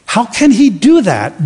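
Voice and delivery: ordinary talk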